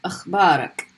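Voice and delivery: akhbarak with throaty kh, ending in a light k; model word akhbarak. Shown as a demonstration. Teacher models akhbarak for students to repeat. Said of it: "end it with the kaf".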